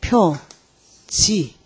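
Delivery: '표지'를 두 음절로 아주 느리게 늘여 끊기; '표지', 표와 지 사이를 띄워 읽기. Shown as a demonstration. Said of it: "요렇게 읽으면 뭐예요?". ('표지'를 한 글자씩 길게)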